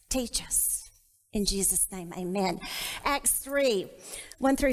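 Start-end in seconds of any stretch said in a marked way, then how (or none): 0.1-0.8 whisper. Real, not acted. Whispered plea in prayer. 1.3-2.6 prayer. Acted. Pastor praying over the reading, hushed and reverent.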